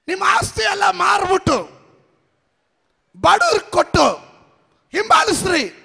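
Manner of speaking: high, loud preacher's challenge; full-force preaching. Acted. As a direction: hammer the challenge to the crowd. (ಜೋರಾದ ಪ್ರಸಂಗದ ಸವಾಲು)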